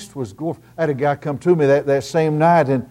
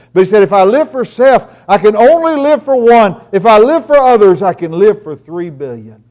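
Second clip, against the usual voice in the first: loud, high fervent preaching; 7 semitones higher, 11 dB louder than usual. Acted. Preacher urging his church to live for others, loud and fervent. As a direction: drive it home from the pulpit.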